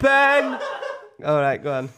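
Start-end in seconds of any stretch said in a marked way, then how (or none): 0.0-0.6 high, loud drawn-out call; Kat. Acted. Girlfriend calling her boyfriend, high loud impersonation. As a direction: bellow the name in a high voice. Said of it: "Kat, Ben's girlfriend".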